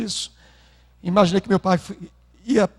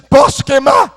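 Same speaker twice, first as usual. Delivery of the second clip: father's loud question; 9 semitones higher, 8 dB louder than usual. Retold or imitated. Father asks to burn money.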